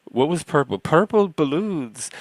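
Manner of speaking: put-on high, slow, sing-song Irish accent, like the leprechaun in an old ad; naming the marshmallows like the Lucky Charms leprechaun, in an Irish accent; mock Irish leprechaun accent. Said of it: "they'd all be in an Irish accent".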